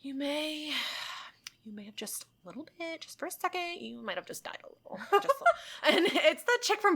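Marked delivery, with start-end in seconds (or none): 0.0-5.0 soft nurse. Retold or imitated. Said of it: "And she goes". 5.1-6.0 whispered through a laugh. Retold or imitated. Retelling the movie nurse, whispering through a laugh. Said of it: "And she goes".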